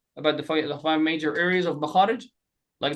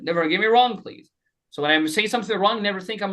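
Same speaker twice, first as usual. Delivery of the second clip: usual speaking voice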